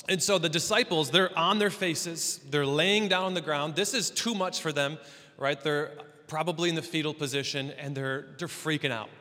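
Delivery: ordinary delivery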